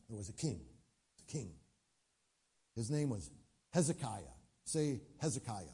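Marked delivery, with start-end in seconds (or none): 0.1-1.5 softer, dropping the voice on a king; hushed storytelling. Acted. Preacher hushing the congregation before the story. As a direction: draw them in with a hushed beat.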